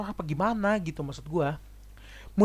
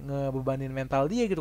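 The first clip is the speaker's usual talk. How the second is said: normal talking voice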